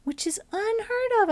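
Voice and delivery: high, astonished exclaim; amazed. Real, not acted. Streamer marvelling at a unit dodging every trap, amazed. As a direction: sound truly stunned by it. Amazed at enemy avoiding traps.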